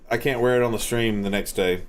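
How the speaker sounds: ordinary delivery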